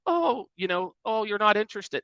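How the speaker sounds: high, whiny oh, I guess you're not interested; mock the guilt-trip line; mock needy salesperson. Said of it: "it's just stupid".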